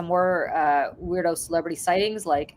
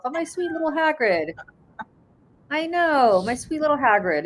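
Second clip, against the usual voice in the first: doting; 9 semitones higher than usual. Real, not acted. Doting on her dog.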